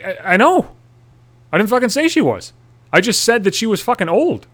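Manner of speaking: high, indignant self-defence; exasperated protest. Retold or imitated. Defending his words to his girlfriend, exasperated high protest. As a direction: protest in worked-up mock outrage.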